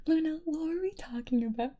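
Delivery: high, loud puzzled question; mock confusion. Real, not acted. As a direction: ask it baffled and loud.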